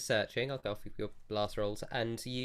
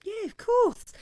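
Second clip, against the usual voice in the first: much higher, louder delighted trader; excited squeal; 9 dB louder than usual. Acted. Branderi agrees to sell colours, high and delighted. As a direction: squeal yes like an eager merchant.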